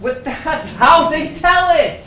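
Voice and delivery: laughing. Real, not acted. Laughing retort in playful argument.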